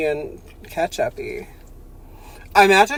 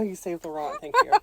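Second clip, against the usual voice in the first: ordinary talk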